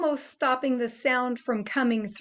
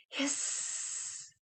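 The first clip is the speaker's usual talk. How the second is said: soft voiceless hiss; very soft snake hiss; 2 semitones higher, 15 dB quieter than usual. Shown as a demonstration. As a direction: air through closed teeth. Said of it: "putting your teeth together".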